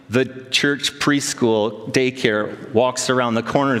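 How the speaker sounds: usual speaking voice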